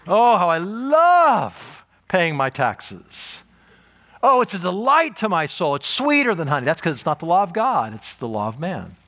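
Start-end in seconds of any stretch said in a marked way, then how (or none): 0.1-6.7 mock rapture. Preacher parodying psalm praise for paying taxes, mock rapture. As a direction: gush in mock adoration, stretching the opening. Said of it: "I have never said that about a stop sign or the I R S".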